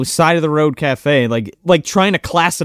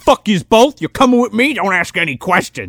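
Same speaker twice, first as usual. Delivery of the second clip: mock tough guy; 2 semitones higher than usual. Hero bossing the woman.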